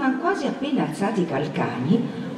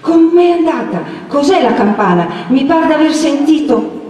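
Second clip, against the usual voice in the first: high, loud flustered questions; agitated, just arrived running; breathless anxiety; 9 semitones higher, 4 dB louder than usual. A narrator's reading. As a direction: fire off worried questions while winded. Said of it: "che arrivava tutta affannata".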